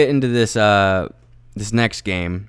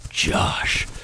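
slow, low dramatic whisper; 6 semitones lower, much slower than usual. Acted. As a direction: draw out the name in awe. Crowning his MVP candidate.

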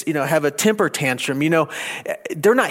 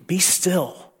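slow, softer be still; slow soft verse; 4 dB quieter, slower than usual. Shown as a demonstration. Preacher reading Psalm forty-six slowly and softly. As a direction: let each word settle. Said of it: "slow quoted verse".

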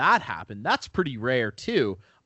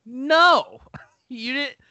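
high, loud, insistent no; emphatic reassurance; 9 semitones higher, 4 dB louder than usual. Retold or imitated. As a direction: tell him hard he did nothing wrong. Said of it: "And we were like".

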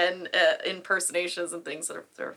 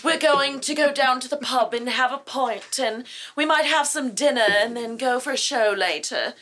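very proper British accent, pitched up; high British; 5 semitones higher, 3 dB louder than usual. Shown as a demonstration. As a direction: put on a proper upper-class British accent. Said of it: "very proper".

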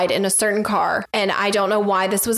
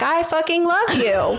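higher, slow mock yell; mock yell back; 7 semitones higher than usual. Mom yelling back at her son, higher mock yell. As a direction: yell the love right back at him. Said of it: "And I yelled back".

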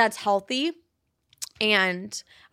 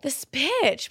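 mock outrage; 5 semitones higher than usual. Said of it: "I was like".

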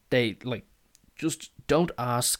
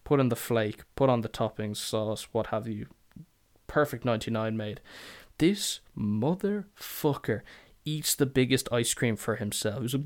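usual speaking voice

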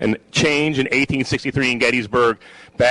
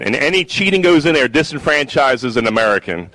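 ordinary talk

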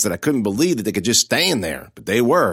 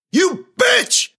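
much higher, louder scream; 4 dB louder than usual. A narrator's reading. Bully screaming at the girl who hurt him, a shriek. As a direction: scream it with rage. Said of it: "he screamed".

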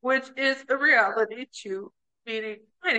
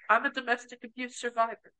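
usual speaking voice

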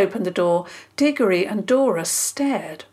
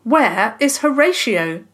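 louder, higher, bold challenge; loud demand; 4 semitones higher, 6 dB louder than usual. Acted. Boy confronting angry neighbour, loud demand. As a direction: stand up to him and demand it. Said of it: "Diggory said loudly".

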